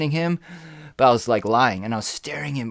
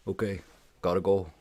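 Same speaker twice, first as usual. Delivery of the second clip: much lower, softer quick goodbye; hasty mumble; 5 semitones lower, 5 dB quieter than usual. Retold or imitated. Friend sees the gun and quickly ends his call, low and soft. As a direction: mutter it and bail.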